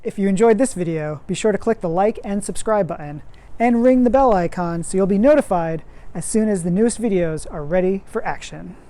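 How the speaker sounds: fast, bright sign-off; upbeat outro. Acted. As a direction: rattle off the outro. Outro read.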